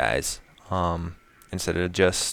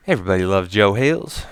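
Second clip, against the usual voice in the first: fast, louder mock Texas accent; terrible Texas accent; 5 dB louder, faster than usual. Praising a viewer in a mock Texas accent. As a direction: ham up a bad Nashville accent for a viewer. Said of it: "that was my terrible Texas, Nashville accent".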